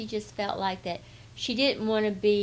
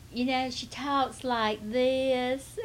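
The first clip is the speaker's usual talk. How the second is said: put-on drawn-out, higher-pitched proper Southern lady accent; Buckhead Betty talk; 6 semitones higher, slower than usual. Friend demonstrates Buckhead Betty talk, drawn-out Southern lady accent. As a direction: stretch it slowly into genteel Southern lady talk. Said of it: "what we call Buckhead Betty talk".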